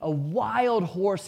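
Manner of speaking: plain reading voice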